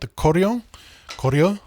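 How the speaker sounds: "Koryo" sounded out with a rising end; careful repeat pronunciation. Shown as a demonstration. Lecturer correcting himself on the name, careful pronunciation. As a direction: say "Koryo" deliberately twice, as a model.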